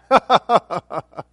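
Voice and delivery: loud staccato laugh. Real, not acted. Preacher laughs after mimicking believers who refuse, loud laugh. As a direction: laugh out hard in a quick string.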